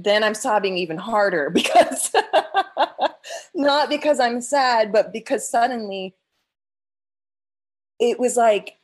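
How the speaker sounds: real laugh breaking into the words; laughing through it. Real, not acted. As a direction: let a laugh spill out while explaining. Laughing while recounting tears.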